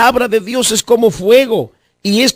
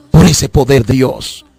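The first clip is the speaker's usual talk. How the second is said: prayer altar call. Acted. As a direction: pray with them, pleading and tender. Altar call prayer over music.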